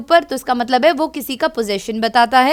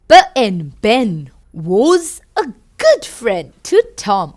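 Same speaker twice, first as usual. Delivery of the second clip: storybook reading; 3 semitones lower, 5 dB louder, much slower than usual. A narrator's reading. Reads storybook to children.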